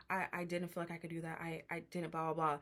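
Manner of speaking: softly imitated timid excuse; low-key scared. Housemate answering an angry Jocelyn, quietly cowed. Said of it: "she was low-key getting scared".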